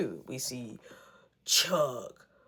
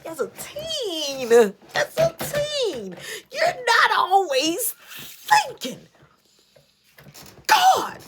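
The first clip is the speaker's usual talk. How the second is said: high, slow, wailing half-laugh; dramatic lament; 11 semitones higher, 4 dB louder, much slower than usual. Real, not acted. Warning viewers that teens don't think, slow high wail. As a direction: stretch it out like a lament. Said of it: "lesson to viewers about teens".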